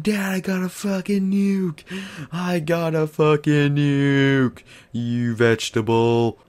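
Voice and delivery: high, drawn-out mock scream to dad; retold screaming. Kid telling his dad about the nuke, high mock scream. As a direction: reenact a kid's triumphant screaming, stretched out slow. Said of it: "I fucking started screaming".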